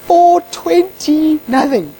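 high, drawn-out exasperated pleading; freaking out. Retold or imitated. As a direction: plead in rising panic as time runs out. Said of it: "I'm freaking out".

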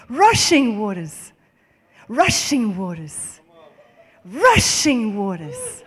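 RUSHING hit loud and drawn out, slowly; emphatic repetition. Real, not acted. Preacher stuck on the verse's rushing waters, emphatic repetition. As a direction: savour "rushing waters", leaning hard and long on RUSHING.

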